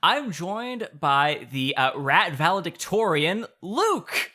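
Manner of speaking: loud show-host welcome, slowed. Acted. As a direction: welcome the audience like a radio host. Hosts segment intro.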